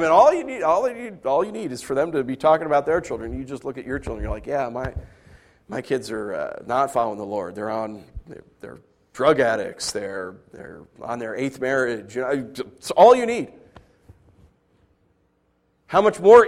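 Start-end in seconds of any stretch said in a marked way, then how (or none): 4.5-12.2 low, dropped, downcast admission; lightly voiced ashamed parent; defeated parent. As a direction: sigh it out like a beaten-down parent. Parent admits kids went astray.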